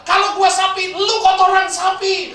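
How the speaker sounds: loud, high, angry comeback, last words slowed; angry retort. Retold or imitated. Angry Innova driver yelling back at the truck driver. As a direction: snap back furiously, drawing out the insult. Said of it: "Sopir Innova marah".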